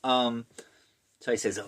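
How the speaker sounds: ordinary delivery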